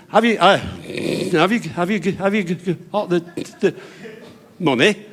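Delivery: long blocked stammer, bursting out. Acted. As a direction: struggle slowly to get each word out. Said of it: "Freddie stammered a bit".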